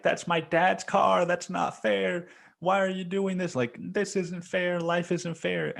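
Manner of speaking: high-pitched protest of an upset sister; imitated yelling. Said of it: "yelling out".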